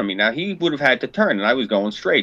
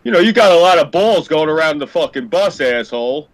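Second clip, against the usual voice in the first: imitating the other driver; 4 semitones higher, 5 dB louder than usual. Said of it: "so he goes like this".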